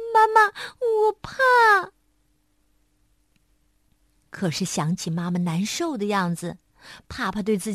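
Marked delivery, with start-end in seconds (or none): 0.1-1.9 high, slow, loud tearful cry; a child frozen with fear calling for mother; trembling little monkey. A narrator's reading. Little monkey sees a caterpillar, slow high frightened cry.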